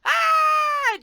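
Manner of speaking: high, loud hammed-up scream of someone falling; a comic scream as the boy tumbles; mock fall scream. Acting out falling down.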